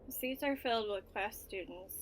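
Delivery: ordinary talk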